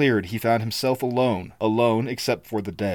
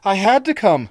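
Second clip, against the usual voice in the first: higher, louder, a touch of a sob; sobbing girl; 9 semitones higher, 6 dB louder than usual. Acted. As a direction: plead with him through tears. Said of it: "she sobbed".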